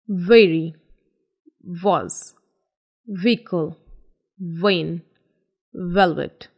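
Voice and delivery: Very, was, vehicle, vein, velvet, each v buzzed; V with vibration. Shown as a demonstration. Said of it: "हल्का सा vibration आना चाहिए".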